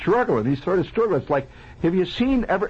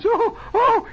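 high, loud mock moans of panic; terrified wailing; 3 dB louder than usual. Patient at the elevator holding his head, high panicked wail. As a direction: imitate a man wailing in terror. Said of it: "in just total terror".

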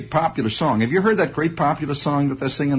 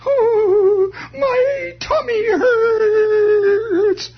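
very high, slow, loud wailing doll; a toy doll crying out in pain; squealing doll moaning about its tummy; tortured doll voice; 5 dB louder, much slower than usual. Retold or imitated. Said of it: "a tortured doll voice".

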